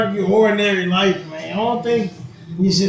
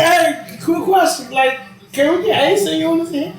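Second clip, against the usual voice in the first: asking Jesus; 8 semitones higher than usual. Acted.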